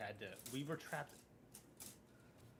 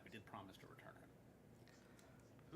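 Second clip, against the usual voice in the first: soft, slow whispered aside; 3 semitones lower, 16 dB quieter, slower than usual. Real, not acted. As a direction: whisper it under your breath. Unvoiced aside.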